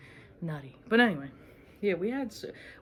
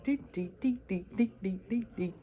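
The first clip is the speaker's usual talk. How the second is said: dazed singsong "dee, dee, dee", starting higher; high, spaced-out singsong; 3 semitones higher than usual. Real, not acted. Stoned on camera, showing her eyes drifting in a higher singsong. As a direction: drift off in a stoned, sing-song hum. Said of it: "I'm fucking high".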